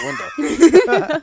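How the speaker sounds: laughs through the words; laughing. Real, not acted. Guide laughing while blaming the big bull. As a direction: breaks into a high laugh mid-line.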